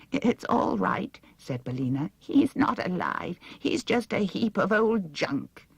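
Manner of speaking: slow, high soothing chicken; calm hen voice. A narrator's reading. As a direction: settle the girl down gently. Bellina the hen speaks.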